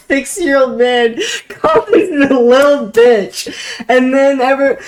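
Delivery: high and giggly; real laughter breaking the words; laughing. Real, not acted. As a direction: laugh your way through it. Laughing at own story.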